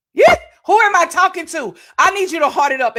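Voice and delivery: high, loud call to the viewers; fired-up preacher calling out to the viewers; rousing call out. Real, not acted.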